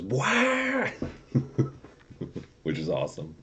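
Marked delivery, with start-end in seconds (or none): 0.0-0.9 high squealing wah of a revving engine; mock engine wail. Reading a car sticker to chat, mock VTEC wail. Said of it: "sticker on his back windshield that says".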